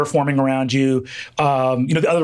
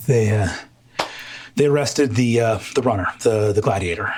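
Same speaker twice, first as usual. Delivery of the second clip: out of breath; 7 semitones lower than usual. Acted. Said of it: "sort of huffing and puffing".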